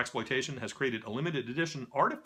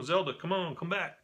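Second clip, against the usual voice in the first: higher-pitched coaxing call; calling a pet; 6 semitones higher than usual. Real, not acted. Host breaking off to call Zelda back, coaxing.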